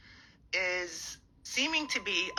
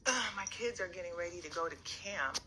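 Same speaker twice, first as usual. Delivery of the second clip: ordinary talk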